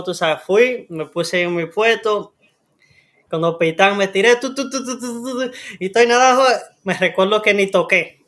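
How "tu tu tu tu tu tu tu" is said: high rapid "tu" patter; high-pitched racing-stroke imitation; imitated swimming noise. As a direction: sputter out frantic strokes in high syllables. Imitates frantic swimming in first race.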